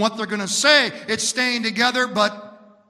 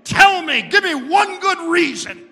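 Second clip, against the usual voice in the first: high, loud frustrated roar; irritated devil; 11 semitones higher, 7 dB louder than usual. Acted. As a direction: demand it like a fed-up devil. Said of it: "finally irritated and frustrated".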